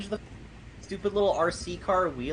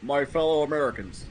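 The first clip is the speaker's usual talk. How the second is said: dramatic presidential address; 3 semitones lower than usual. Pretend. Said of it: "really dramatic speech".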